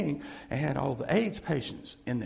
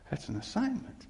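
laughing; 4 semitones higher, 5 dB quieter than usual. Real, not acted.

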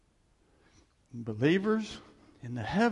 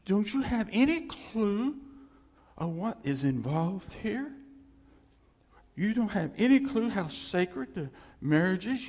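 indignant, raised scolding; God getting mad; 5 semitones higher than usual. Acted. God scolding people who dishonour marriage, indignant. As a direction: press each question, appalled. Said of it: "that's what made him mad".

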